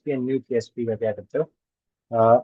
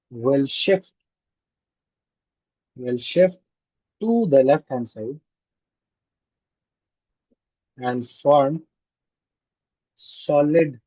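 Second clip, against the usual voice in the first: word by word, long pauses, rising ends; slow dictation; much slower than usual. Real, not acted. Teacher dictating a solubility conclusion to the class, slow dictation. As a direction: dictate slowly in chunks for note-takers.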